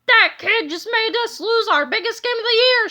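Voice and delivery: sore, shouting coach; angry shout. A narrator's reading. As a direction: yell about the lost game. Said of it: "shouted Mister Meaner".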